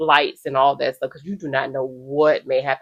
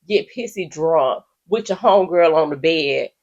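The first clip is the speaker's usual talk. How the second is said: normal talking voice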